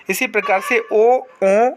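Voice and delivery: ordinary talk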